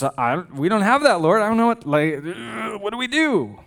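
lost for what to do; panicked disciples. Retold or imitated.

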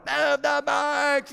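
drawn-out high shout from a car window; exasperated dad yelling at the kids; angry yell. Retold or imitated. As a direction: bellow it, stretching the words.

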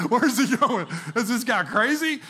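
imagined friend's line broken by laughter; laughing through the quote. Retold or imitated. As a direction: giggle through the words. Imagined friend waking, teller laughing.

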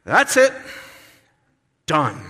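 sharp final verdict, hammed up; mock fed-up God. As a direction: snap it shut like a fed-up parent. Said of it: "God is like".

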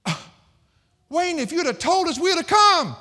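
high, loud mocking whine of a church member; mock congregant. Pastor mimicking a member who missed the sermon, high and loud. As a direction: squeal it like a surprised church member.

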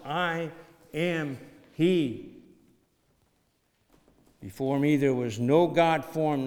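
loud, ringing scripture reading; preaching scripture. Acted. Preacher reading Isaiah aloud to the church, booming. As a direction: proclaim it to the congregation at full force.

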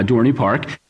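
ordinary delivery

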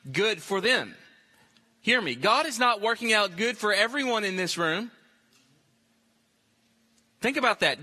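ordinary delivery

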